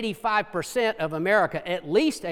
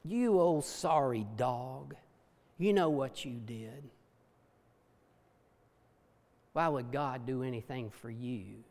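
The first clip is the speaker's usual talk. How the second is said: accusing voice; 5 semitones lower, 8 dB quieter than usual. Acted. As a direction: sneer it like the accuser in your head. Voicing the accusing inner voice.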